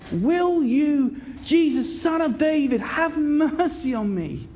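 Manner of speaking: high, stretched-out calling; unabashed shouting. Acted. Blind beggar pleading with Jesus for mercy, unabashed calling. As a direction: cry out without shame, drawing out the plea. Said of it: "he's shouting unabashed".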